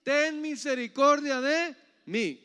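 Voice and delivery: high, loud plea for mercy; crying out. Acted. Blind man begging Jesus for mercy, crying out. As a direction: call out loudly, drawing out the plea.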